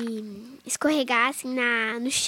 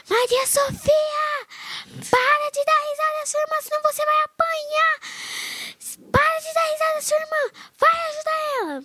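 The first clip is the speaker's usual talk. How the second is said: high, loud angry mother yelling; brazilian mom scolding; 4 dB louder than usual. Retold or imitated. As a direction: shriek at the laughing daughter, very high. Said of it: "Aí minha mãe falou assim".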